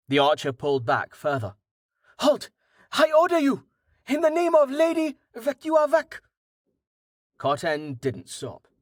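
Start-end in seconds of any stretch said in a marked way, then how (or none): 2.2-6.2 very high-pitched official command; high, insistent challenge; shouted order. A narrator's reading. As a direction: proclaim it high, demanding obedience. Archer shouts formal order at advancing man.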